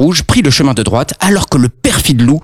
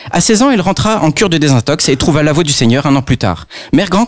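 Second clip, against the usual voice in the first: plain reading voice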